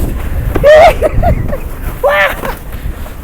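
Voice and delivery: high, airy burst of laughter; breathless laugh. Real, not acted. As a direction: laugh off the sudden gust. Laughing at a big gust.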